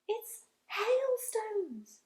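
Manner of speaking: slow, high, hushed discovery; excited whisper. Acted. James to his friends, hushed excitement. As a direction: breathe it out in wonder. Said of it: "whispered James excitedly".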